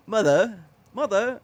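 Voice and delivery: put-on British accent. Friend joins the British accent bit, loud and high. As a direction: lilt "Mother" in a British accent. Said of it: "with that British accent".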